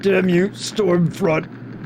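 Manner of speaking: very slow, dramatic curse; mock curse. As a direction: drag out the curse like a villain's victim.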